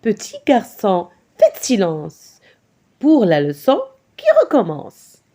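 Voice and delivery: measured, rhythmic poem reading, phrase by phrase; poem recitation. Acted. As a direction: recite it to a class with clear, even beats.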